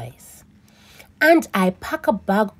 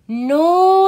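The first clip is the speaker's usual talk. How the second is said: firm no; 9 semitones higher, 7 dB louder than usual. Acted. Grandpa refusing.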